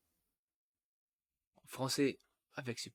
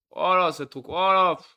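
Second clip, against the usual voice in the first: loud, higher exasperated oh là; exasperated outburst; 5 semitones higher, 13 dB louder than usual. Real, not acted. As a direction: blurt it out, fed up with the computer. Galère avec WhatsApp sur l'ordi. (agacé et fort, dépassé par l'ordi)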